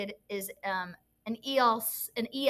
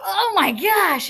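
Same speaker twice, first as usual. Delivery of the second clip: slow, loud shocked gasp of a class; shocked kids; 6 semitones higher, 9 dB louder, much slower than usual. Retold or imitated. Teacher mimics her class gasping loudly at the story. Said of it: "the kids immediately gasp".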